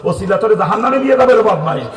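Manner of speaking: loud, high pulpit tirade; full-force scolding sermon; berating shout. Real, not acted. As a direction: shout the warning at an offender. Preacher berating an imagined offender.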